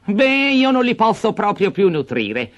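high, angry insistence; angry stepmother. Acted. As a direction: refuse him flatly. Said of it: "rispose la moglie arrabbiata".

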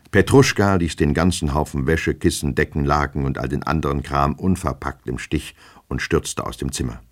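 plain reading voice

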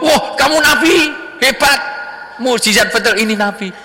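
very high awestruck acted cry; hammed-up astonished praise; amazed exclamation. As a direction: marvel aloud at a miracle. Acted amazement at revived goat.

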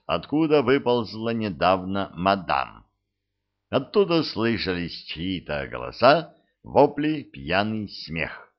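ordinary delivery

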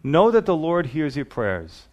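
usual speaking voice

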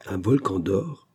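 slow, doubting echo; drawn-out disbelieving question; incredulous. A narrator's reading. Engineer doubting the dying man's gold story, slowly incredulous. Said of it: "d'un ton qui exprimait une certaine incrédulité".

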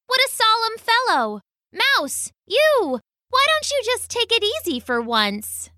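very high scoffing bird voice; scoffing magpie. Acted. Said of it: "said the magpie".

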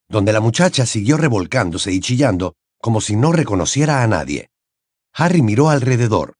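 ordinary delivery, nothing marked

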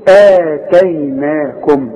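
آتيناكم very slowly, opening aa stretched out; long madd. Shown as a demonstration. Teacher showing the madd, drawn-out آتيناكم. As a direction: hold each long vowel of آتيناكم. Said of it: "وبعدها المد بتاعها تقول إيه؟".

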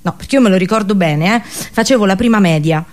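ordinary talk

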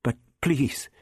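timid stammered appeal; anxious faltering plea. A narrator's reading. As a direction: plead nervously, then halt lamely. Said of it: "Barbara halted lamely".

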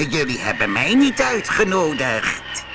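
shrill angry cry; angry fairy. Acted. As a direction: call out in anger, wicked fairy. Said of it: "riep ze".

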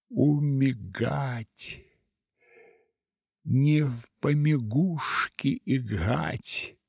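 slow, burring the r in Умигать and помигушки; burred r. Acted. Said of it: "Вдруг картавя сказал".

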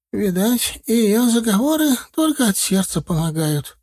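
put-on high, chattering old granny voice; old village woman. A narrator's reading. Старушка в сером платке жалуется на деда, высокий старческий голос. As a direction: tell it like a fussy village grandma.

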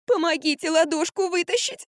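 high, whiny plea; whimpering. A narrator's reading. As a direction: whimper for help. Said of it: "захныкала Франк". (плаксивое хныканье)

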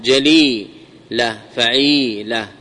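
جليلة، فعيلة slowly, each long ee held; syllable-by-syllable demo. Shown as a demonstration. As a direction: stretch ja-LEEE-la, fa-'EEE-la in جليلة، فعيلة. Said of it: "يعني حرف واحد". (جليلة، فعيلة ببطء مع مد الياء)

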